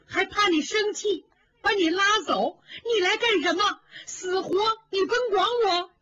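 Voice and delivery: crying reproach. Acted. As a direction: let the tears break into the words. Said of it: "更哭了".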